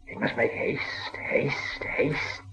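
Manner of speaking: soft slow unvoiced creature whisper; menacing whispered hurry; Gollum whisper. A narrator's reading. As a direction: hiss it urgently like a creeping creature. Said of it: "said Gollum, whisper".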